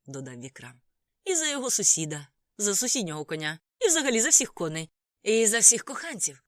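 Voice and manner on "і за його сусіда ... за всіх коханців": loud, high, mounting toast; proclaimed toast. A narrator's reading. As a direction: proclaim it to the whole table. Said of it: "проголосив Дід'є".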